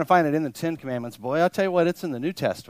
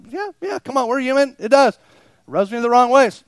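normal talking voice

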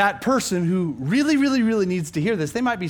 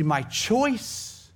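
"choice" drawn out and raised; stress on choice; 5 semitones higher than usual. Real, not acted. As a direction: lean slowly on the word "choice". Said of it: "the word choice, guys, it's critical".

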